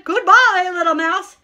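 high, loud, hasty goodbye; hammed-up frightened farewell; alarmed farewell. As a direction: call it out in mock panic. Frightened snake hurries away.

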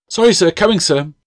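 high, flustered reply; nervous. Acted. Apprentice girl called by the master, answering nervously. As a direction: rush it out, startled and nervous. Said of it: "she replied nervously".

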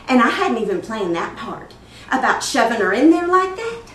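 loud, animated, swooping up at the end; excited confession. Acted. Mother amazed at her unplanned act, loud excited confession. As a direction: marvel loudly at the unplanned part.

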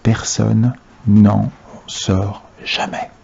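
very slow, low and hushed; hushed, drawn-out confidence with pauses; confidential whisper. A narrator's reading. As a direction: share a secret, one word at a time. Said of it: "baissa la voix pour chuchoter confidentiellement".

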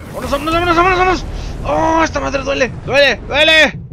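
very high, loud panicked cries; frantic screaming. Real, not acted. Gamer taking damage while fleeing, high frantic screams. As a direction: shriek while getting hit.

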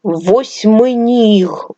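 put-on восьминіг drawn out slowly for a child; slow sounding out. Shown as a demonstration. Teacher to child, восьминіг said very slowly. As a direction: stretch every sound of восьминіг. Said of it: "Далі".

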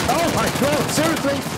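high-pitched incredulous complaint; exasperated disbelief. Real, not acted. Gamer failing the fight, exasperated. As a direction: protest as if you cannot believe it. Killed again in game.

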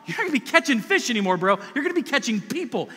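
high, loud, urgent encouragement; Jesus, fervent. Retold or imitated. Preacher voicing Jesus urging Peter to stand up, loud and fervent. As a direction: lift him up like a big brother. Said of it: "and Jesus says".

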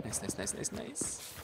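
soft unvoiced repeated nice; hushed gleeful whisper. Real, not acted. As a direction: whisper it over and over, pleased. Quiet gloating while sneaking.

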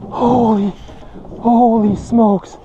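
slow, high-pitched amazement; awestruck. Real, not acted. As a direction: draw the words out high in real disbelief. Stunned by a personal best catch.

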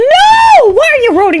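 high mock shriek of no; hammed-up outraged scream; mock horror.

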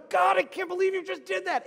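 burning-hot anger, high and loud; Jonah. Acted. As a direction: cry out in acted outrage at God. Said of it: "means burning hot".

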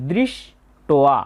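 দৃষ্টয়া slow, ব ফলা as an o sound; correct Sanskrit pronunciation. Shown as a demonstration. Teacher showing students the ব ফলা sound, slowly. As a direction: draw out দৃষ্টয়া with an o vowel after ষ্ট. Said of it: "এখানে ও কার হবে".

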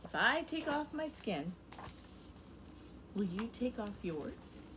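normal talking voice

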